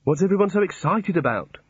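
a touch of desperation; desperate. A narrator's reading. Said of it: "said Rincewind desperately".

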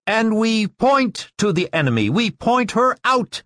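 high, ringing call to the class; sudden high-pitched, projected declaration; loud voice. A narrator's reading. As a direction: proclaim it, pointing out the culprit. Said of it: "in a loud voice, said".

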